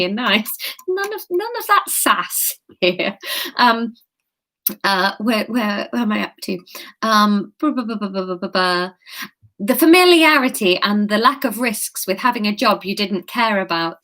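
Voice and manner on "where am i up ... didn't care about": usual speaking voice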